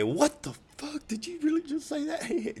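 very high, squealed disbelief; incredulous outburst. Retold or imitated. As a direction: squeak it out, stunned at your buddy. Retelling own shock at friend's confession.

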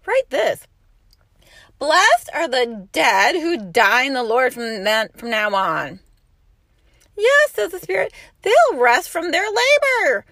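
ringing scripture proclamation; fervent scripture reading. Acted. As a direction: proclaim it like a preacher.

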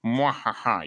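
evil villain cackle, low; mustache twirling villain. Retold or imitated. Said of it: "twirling his mustache".

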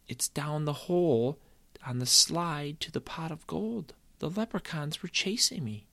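put-on high, breathless child's telling; boy explaining to mom where his shoe went; earnest boy. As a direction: make mom believe the leprechauns.

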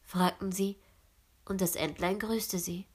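usual speaking voice

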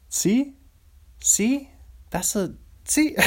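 high, laughing "See? See?"; self hype. Real, not acted. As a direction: cheer yourself on after a joke. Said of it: "that's what you do when".

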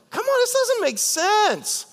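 slow high pleading exclamation; high exasperated cry. Acted. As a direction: wail it up high.

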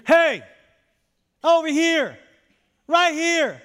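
loud, high call across a crowd. Acted. Parent to a lost kid in a crowd, calling out loudly. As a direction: holler to get someone's attention. Parent calls to straying child.

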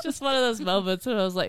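ordinary talk

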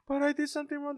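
put-on tiny, high, soft child voice; little daughter. Daughter afraid she did wrong, in a high child voice. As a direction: imitate a worried little girl. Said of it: "he saw his daughter".

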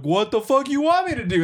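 mock indignant. Woman's retort in road quarrel.

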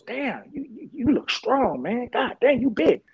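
high-pitched imitation of an amazed street guy; impressed stranger. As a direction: size him up in mock awe. Retold stranger sizing him up.

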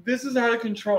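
high, hammed-up despair; mock crying. Man retelling his meltdown on the phone, mock crying. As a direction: imitate yourself losing it, pitched high. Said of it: "I'm just crying".

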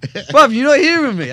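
high, loud insisting. Retold or imitated. Mate didn't react, so he raises his voice insistently. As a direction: push it, he isn't listening. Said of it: "And I went".

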